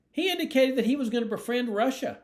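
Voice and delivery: high, laughing mock horror; mock alarm. Candidate ridiculing alarm over befriending Russia, mock-horrified.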